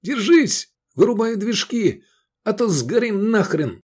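high, loud urgent orders; shouting. A narrator's reading. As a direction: yell to brace for impact. Crew shouts during crash.

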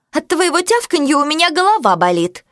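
a touch of the annoyed owner; irritated woman. A narrator's reading. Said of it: "промолвила Сюзанна".

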